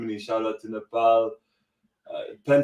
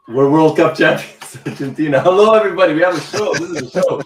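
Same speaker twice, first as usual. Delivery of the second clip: high, loud celebration; ecstatic; 5 semitones higher, 10 dB louder, faster than usual. Real, not acted. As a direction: shout it with pure joy. Ecstatic celebration, stream kickoff.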